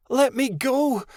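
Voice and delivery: high shrill scream to be freed; struggling free in panic. A narrator's reading. Trapped Midnight Star screams at the dragon. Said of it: "she screamed".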